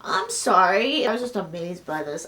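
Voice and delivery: ordinary talk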